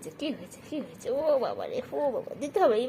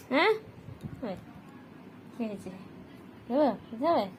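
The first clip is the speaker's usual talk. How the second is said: ordinary talk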